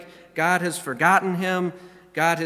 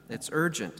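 urgent, quieter; 3 semitones lower, 6 dB quieter than usual. Shown as a demonstration.

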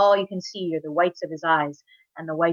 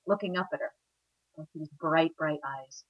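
usual speaking voice

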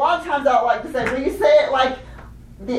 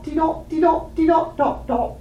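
higher, rhythmic British-accented chant; 6 semitones higher than usual. Shown as a demonstration. Teacher models the chant for singers, in British accent. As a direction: chant the syllables with rounded British vowels. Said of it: "in your best British accent".

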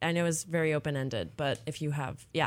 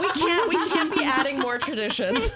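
louder, higher mock protest through laughter; laughing protest; 6 semitones higher, 6 dB louder than usual. Real, not acted. Host jokingly refusing her co-host's new tradition, laughing loudly.

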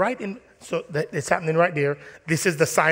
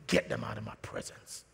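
hushed disgust; 7 semitones lower, 10 dB quieter than usual. Acted. Preacher to church, voicing quiet disgust for someone. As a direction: let the contempt seep out in a near whisper.

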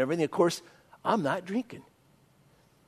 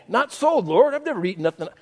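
mock Peter protest; 8 semitones higher than usual. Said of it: "I was doing the Peter thing".

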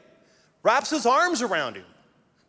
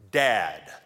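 normal talking voice

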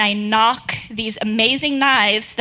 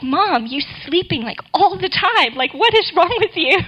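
higher-pitched mock kid voice; teasing daughters; 7 semitones higher than usual. Daughters laughing at tired mom, higher teasing voice. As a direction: tease Mom like her daughters did.

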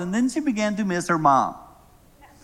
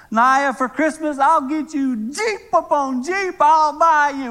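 loud, high, frantic promises; desperate consoling grandfather; 9 semitones higher, 4 dB louder than usual. Retold or imitated. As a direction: plead with a crying child, voice pushed up.

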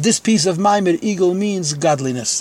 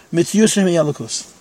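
ordinary delivery

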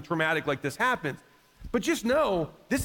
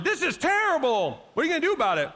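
hammed-up shocked church crowd, higher; mock outrage; 8 semitones higher, faster than usual. Church in America reacting, mock outraged. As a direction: parody empty outrage. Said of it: "the church in America kinda has that same response".

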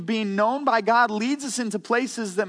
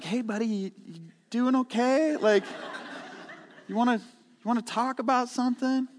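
concerned gentle check-in; 4 semitones higher, 5 dB quieter than usual. Retold or imitated. Elders gently checking on pastor.

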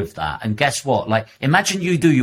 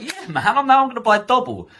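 much higher, fast and gleeful; mocking a gleeful bargain hunter, much higher; mock excited shopper. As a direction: laugh it off and grab double. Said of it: "You're just like".

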